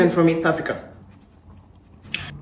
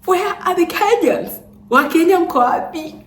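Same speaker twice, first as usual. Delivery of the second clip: calling out; 11 semitones higher than usual. Real, not acted. Calling out to Kenyans.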